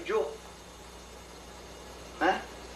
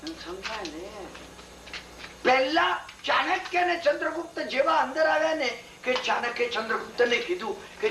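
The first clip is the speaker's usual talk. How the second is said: normal talking voice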